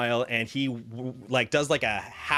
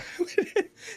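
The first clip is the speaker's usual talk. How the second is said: high laugh. Acted. [name] laughs before speaking.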